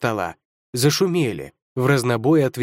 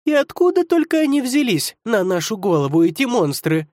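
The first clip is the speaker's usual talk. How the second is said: high, heartfelt outcry; exasperated exclamation; 9 semitones higher than usual. Acted. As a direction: throw up your hands and exclaim. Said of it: "в сердцах воскликнула Ига".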